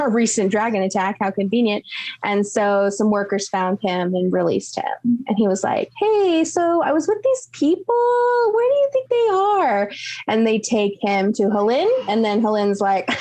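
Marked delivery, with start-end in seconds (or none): 6.0-9.9 cheerful, raised-pitch adventurer voice; lightly voiced Hugo. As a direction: play Hugo brightly, a bit higher.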